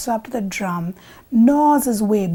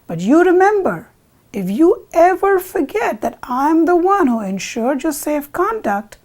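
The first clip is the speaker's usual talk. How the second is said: raised, louder warning with heavy emphasis; jackal's emphatic warning to the bull; stern warning; 5 semitones higher, 5 dB louder than usual. Acted. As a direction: lay down a threat, higher and forceful. Jackal warns bull with a threat.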